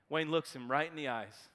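ordinary talk

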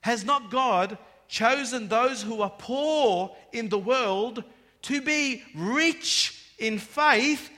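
loud, slow, swelling proclamation; fervent scripture reading. Acted. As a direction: proclaim the verse to the brothers. Reads scripture with rising force.

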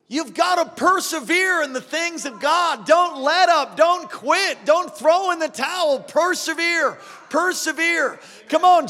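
rising, driving preacher exhortation; fervent revival preaching; preaching at full force. Acted. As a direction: push them to persevere, voice lifted.

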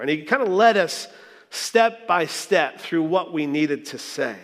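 ordinary delivery

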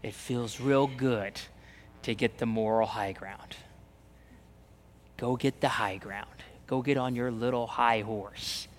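low, hushed, seductive whisper; tempting whisper. Pretend. The old sinful self tempting believers, low whisper. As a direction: whisper like the old life luring you. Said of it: "that wants to pull us in to say".

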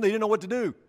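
normal talking voice

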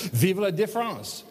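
a light French accent on Vive la différence; French phrase. Shown as a demonstration. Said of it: "I gotta say it in French".

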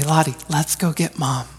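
softly hushed plotting; conspiratorial whisper. Retold or imitated. Dad quietly plotting with his daughter, soft hush. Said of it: "I'll say".

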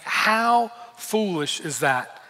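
slow, thoughtful, half to himself; musing disbelief. Real, not acted. Preacher musing on the foolish servant, slow and wondering. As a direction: let the disbelief sink in slowly. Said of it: "I read that verse and I think".

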